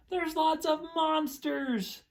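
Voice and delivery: excited. Acted. Excited at book cover.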